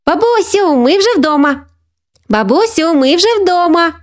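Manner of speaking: put-on very high, singsong child calling out; Софійка's voice. A narrator's reading. Granddaughter phoning grandmother that they got home, excited. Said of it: "пролунав голос Софійки".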